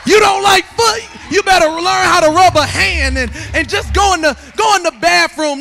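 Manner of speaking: loud, rolling sermon delivery; full-force preaching. Acted. Preacher tells husbands to learn foot rubs, loud preaching. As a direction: belt out the advice like a preacher.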